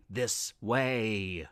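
very low, soft sinister whisper; a lurking creature beckoning; dark voice. A narrator's reading. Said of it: "voice beckoned".

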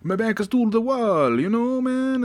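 high, hammed-up Italian voice; Italian accent. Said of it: "That's my Italian voice".

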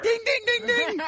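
high sing-song dinging; high ding-ding bell. Retold or imitated. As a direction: ring it out like a bell. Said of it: "Bean's like".

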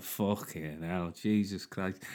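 low, soft mock-Scouse groan; quietly dismayed Scouse impression; gutted Scouser. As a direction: curse slowly under your breath in a Liverpool accent. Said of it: "he must have been gutted".

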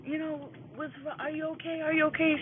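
high, worried kids' voices retold; high-pitched mock-upset child; imitating crying kids. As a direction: imitate anxious children fussing over their auntie. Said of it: "The kids are crying like".

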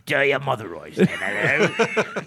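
loud, garbled mock English; hammed-up old English accent; pleasant English accent. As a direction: greet in a broad English accent, breaking up laughing. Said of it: "Do that voice".

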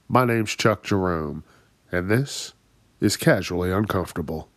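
usual speaking voice

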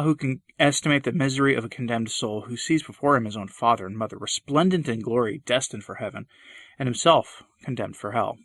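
usual speaking voice

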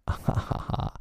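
breathy gloating pirate laugh; villain laugh. A narrator's reading. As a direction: let out a gleeful villain laugh. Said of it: "He burst into laughter".

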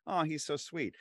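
high-pitched, adoring little coo; fond gushing. Acted. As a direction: gush like a smitten fan. Voices fans' fond reaction.

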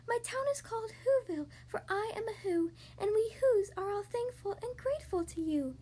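high, small, piping creature voice; tiny mayor's voice. A narrator's reading. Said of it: "called the voice".

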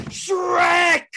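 loud, very high yell of SHREK; panicked scream. Retold or imitated. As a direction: blurt it out at the top of your voice. Screams what was written in panic.

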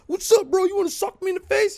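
very high, loud, fast mock tough-guy squeak; squeaky tough guy. Little guy squaring up to the homie again, very high loud squeak. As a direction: puff up and squeak the challenge at him. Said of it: "here comes this fucking John Cena motherfucker".

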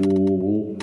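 low, mock Kentucky "Luh-vul"; Kentucky accent. Shown as a demonstration. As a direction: slip into deep Kentucky accent. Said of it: "slip into a Kentucky accent".

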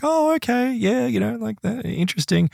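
skeptical. Retold or imitated. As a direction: imitate a doubter politely going along. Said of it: "Totally skeptical".

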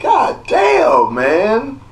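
high, slow, drawn-out god damn; exasperated shout. Real, not acted. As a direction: let it out in disbelief. Exasperated outburst over pressed hoes.

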